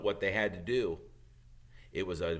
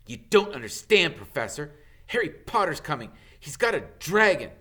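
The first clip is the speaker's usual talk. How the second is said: urgent, louder plea; pleading Malfoy; 6 semitones higher, 8 dB louder than usual. Acted. Malfoy pleads with McGonagall, urgent and loud. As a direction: beg to be believed.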